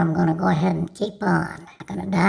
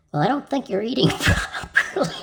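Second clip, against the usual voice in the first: higher pitch, laughter spilling into the punchline; breaking up laughing; 5 semitones higher than usual. Real, not acted. Comedian delivers a doctor's punchline to the audience, cracking up. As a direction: try to say it and crack up. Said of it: "Can't help it".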